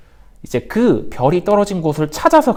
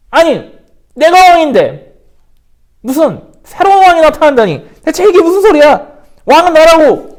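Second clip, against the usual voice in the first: furious king; 8 semitones higher, 8 dB louder than usual. Acted. Herod rages at the news.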